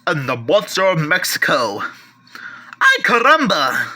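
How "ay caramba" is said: put-on hammy Mexican-accented exclamation; mock Mexican accent. Collector jokes about the Monster of Mexico, mock Mexican accent. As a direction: toss it off in a cartoonish Mexican accent. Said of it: "Okay, I should probably stop".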